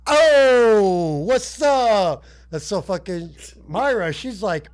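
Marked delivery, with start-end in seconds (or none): 0.1-2.2 loud, high, stretched-out catchphrase; Dice Man impression. Dice Man crowing in the cage, loud and high. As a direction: holler it as a hammed-up comic boast.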